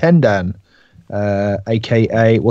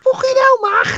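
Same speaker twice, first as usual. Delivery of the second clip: very high, louder Scouse screech; hammed-up whiny Scouse nag; screaming Scouse; 4 dB louder than usual. Co-host joining in the henpecking of Mark, screeching Scouse accent. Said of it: "you two screaming at me".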